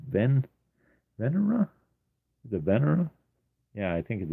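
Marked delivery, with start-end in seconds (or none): 0.1-3.1 "Venera" tried out as a question, pitched much higher with a rise; unsure pronunciation attempt. Real, not acted. Artist puzzling over a Lamborghini name, questioning rise on "Venera". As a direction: sound out "Venera" tentatively, voice lifting at the end. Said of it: "how do you pronounce that?".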